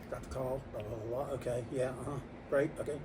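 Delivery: low, soft rattle of a phone call; mock phone mumbling. Shop owner on a call while customers wait, low mumble. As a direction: murmur it like half a phone conversation.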